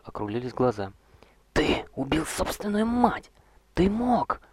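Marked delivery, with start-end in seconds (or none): 1.5-4.4 high, slow appalled cry; horrified. Acted. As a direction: gasp it out in horror. Roger horrified at matricide.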